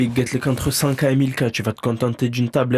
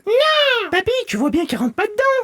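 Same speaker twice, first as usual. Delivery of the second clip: put-on very high child protest; whiny kid. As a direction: complain like a small kid to grandpa. Enfant qui proteste auprès du papy. (enfant qui râle auprès de papy, voix très aiguë)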